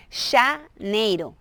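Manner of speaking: JANEIRO slowly, opening on a sh sound; slow model of "janeiro", J as sh; J like che. Shown as a demonstration. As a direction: break "janeiro" out slowly with a hushing first syllable. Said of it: "es como con che".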